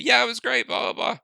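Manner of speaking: dad mimics his kids' cheerful reply; imitating the kids. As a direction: sound like a happy child. Voicing kids' answer.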